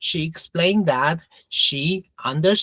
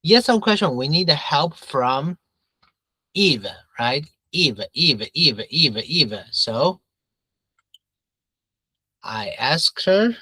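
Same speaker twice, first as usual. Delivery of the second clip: ordinary talk, nothing marked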